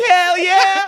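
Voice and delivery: high, loud cheer; excited mock yell. Teasing co-host's imagined eager answer, mock excited yell. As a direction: shout it out like an eager yes.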